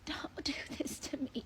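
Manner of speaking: whispered mock despair. Real, not acted. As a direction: drop to a whispered plea. Repeated plea, whispered.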